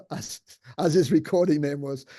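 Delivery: normal talking voice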